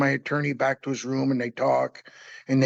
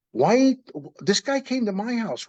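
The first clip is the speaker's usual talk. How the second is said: higher, a retold indignant outburst; replaying his disbelief at the sheriff; indignant protest; 7 semitones higher than usual. Man retelling how he protested to the sheriff, indignant.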